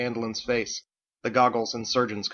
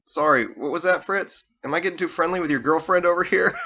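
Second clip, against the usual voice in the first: teasing; 7 semitones higher, 5 dB louder than usual. Acted. Drake teasing Fritz.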